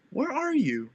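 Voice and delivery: high-pitched mock-mom question; mimicked mom. Retelling her mom asking after her daughter, high mimicked voice. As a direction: put on a mom's high voice.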